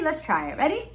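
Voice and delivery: ordinary delivery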